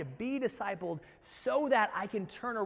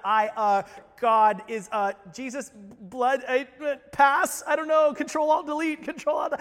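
loud, higher, stumbling mock panic; tongue-tied panic; 5 semitones higher, 9 dB louder than usual. Believer asked about faith by coworker, blurts in loud mock panic. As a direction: sputter like your brain crashed. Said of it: "you find your tongue all twisted up".

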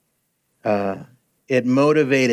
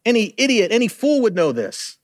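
scornful guard; 7 semitones higher than usual. Retold or imitated. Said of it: "He said".

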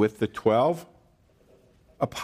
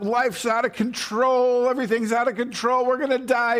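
panicked disciples; 12 semitones higher than usual. Retold or imitated. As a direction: play the terrified disciples in the storm. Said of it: "they're freaking out".

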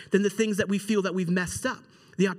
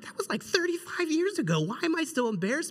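high-pitched mock exasperation at oneself; incredulous, pitch jumping up; embarrassed; 9 semitones higher, 4 dB quieter than usual. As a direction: act out squirming embarrassment. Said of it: "I start blushing".

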